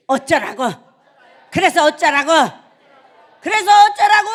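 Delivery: very loud, rising-pitched 'so what' shout; defiant shout. Shown as a demonstration. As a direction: build from loud retort to high full yell. Demonstrates defiant retort, escalating shout.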